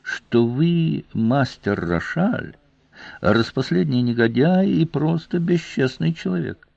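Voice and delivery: high, silky, insulting question; falsely affectionate, lingering delivery; snake-like sweetness. A narrator's reading. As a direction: coo the insult with venomous tenderness. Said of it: "с ласковостью змеи".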